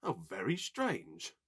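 slow, musing remark; pondering. A narrator's reading. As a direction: mull it over slowly. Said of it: "he pondered".

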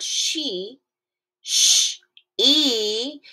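"she" split into "sh" and "e"; "she", then a hissed "sh", then "e"; sounding out. Shown as a demonstration. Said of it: "that E makes a long E sound".

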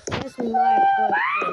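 high loud shriek of joy; shrieking jackpot winner. Real, not acted. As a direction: shriek it like you just won.

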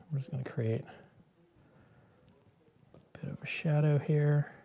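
soft whisper while working. Real, not acted. To herself while shading, a soft whisper. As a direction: whisper it under the breath. Quiet while painting shadow.

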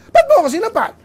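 high, loud cry of a slapped mother; a mother outraged at being slapped back; indignant mother. Retold or imitated. Son slapped mother, retold quarrel.

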